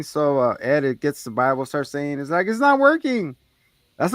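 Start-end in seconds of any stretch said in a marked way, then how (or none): 2.5-3.4 very high retold panicked cry; mock panic. Retelling Ed with the Bible failing, mock panic. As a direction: report it in a high, panicky pitch. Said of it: "they're panicking".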